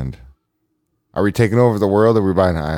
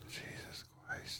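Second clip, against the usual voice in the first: whisper; 27 dB quieter, slower than usual. Real, not acted. Shocked whisper at drug news.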